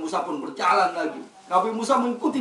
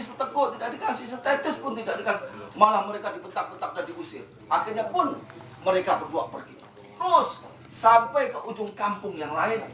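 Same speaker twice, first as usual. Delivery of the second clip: ordinary delivery, nothing marked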